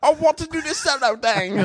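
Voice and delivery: very high, bad British-Australian-Scottish accent. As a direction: squeal out a muddled, badly faked accent. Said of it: "my Australian accent and my Scottish accent".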